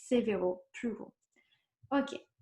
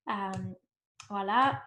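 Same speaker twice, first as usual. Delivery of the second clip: ordinary talk, nothing marked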